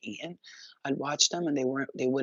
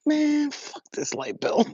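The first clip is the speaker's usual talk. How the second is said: high, slow, loud carefree scoff; slow, high, loud don't-care drawl; stoned dismissal; 12 semitones higher, 5 dB louder, slower than usual. Pretend. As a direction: toss the bill off like you're high. Acting out stoned carelessness.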